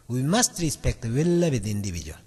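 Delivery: very slow, each chunk drawn out; slow model reading. Shown as a demonstration. Teacher modelling the sentence for students, very slow. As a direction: read it out slowly as one meaning unit. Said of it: "하나의 의미로 딱 압축을".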